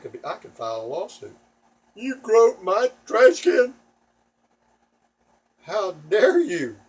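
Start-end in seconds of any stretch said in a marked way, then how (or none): 1.9-6.8 high, loud, slow scandalized cry; mock outrage. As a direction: play the shocked accuser, hamming it up. Mock lawsuit at garbage men.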